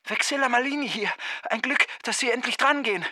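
high, fast voice on the phone; distant phone voice. A narrator's reading. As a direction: talk rapidly from the far end of a phone line. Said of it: "sagte von weit her". (hohe, schnelle Telefonstimme von weit her)

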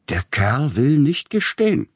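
loud, high-pitched complaint; exasperated officer. A narrator's reading. Policeman shoves in a suspect.

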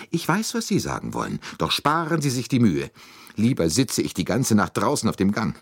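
high, protesting lady; indignant Mrs. Hubbard. Acted. Lady cuts short all objections, indignant and high.

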